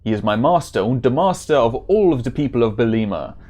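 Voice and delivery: put-on German-accented warrior; hammed-up German accent. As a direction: play it as an earnest German hero. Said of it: "supposed to be a German accent".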